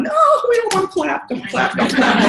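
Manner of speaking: high, fast mock-scared pleading; mock panic. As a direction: plead playfully, pretending to panic. Crowd fears tightrope walker falling.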